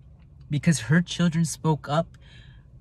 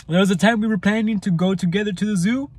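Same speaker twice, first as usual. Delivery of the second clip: ordinary talk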